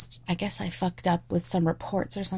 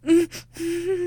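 ordinary talk

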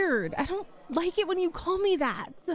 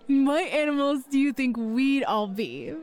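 ordinary talk, nothing marked

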